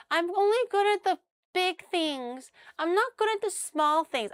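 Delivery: high-pitched imitation of a partner's plea; mock helpless excuse. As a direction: mimic him pleading uselessness.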